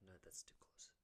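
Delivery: whispered aside to himself; real whispered aside after nearly naming him. Real, not acted. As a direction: hush it like a slip you regret. Nearly revealing a name.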